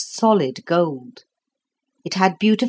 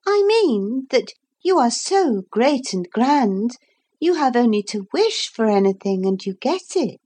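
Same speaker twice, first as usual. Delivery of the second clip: put-on little girl voice; 6 semitones higher than usual. Lucy's voice.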